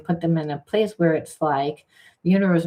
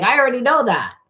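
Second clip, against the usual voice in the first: defensive retort; 7 semitones higher, 5 dB louder, faster than usual. Retold or imitated. Other partner's retort voiced.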